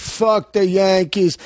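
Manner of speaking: put-on Boston accent. Imitating Boston street.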